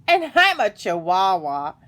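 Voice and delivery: loud, sarcastic boast; snickering fox. A narrator's reading. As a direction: jeer it out loudly, not believing a word. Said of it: "snickered the fox".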